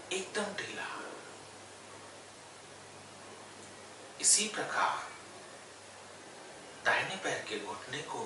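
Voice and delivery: unhurried yoga nidra guidance with long pauses; guided meditation. Real, not acted. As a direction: guide them gently into stillness, phrase by phrase. Leads yoga nidra body relaxation. (ठहर-ठहर कर योग निद्रा का निर्देश)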